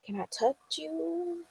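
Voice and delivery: very soft, high, slow mimicry; awed staring onlooker. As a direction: imitate hushed, tentative fascination. Voicing people staring at her.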